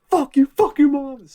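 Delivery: high, loud comeback shout; retold shout. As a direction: yell it back at the heckler. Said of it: "He goes".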